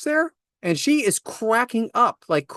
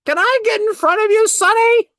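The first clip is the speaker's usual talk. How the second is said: put-on high, quavery old-timer voice, a bit louder; elderly shopper; 14 semitones higher, 5 dB louder than usual. Imitating an old shopper asking to cut ahead, high and quavery. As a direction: play a sweet old person cutting in line. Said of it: "these older folks that I'm talking north of seventy".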